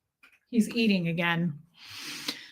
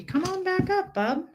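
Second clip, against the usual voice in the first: pet talk; 10 semitones higher than usual. Real, not acted. Coaxing the kitten back up.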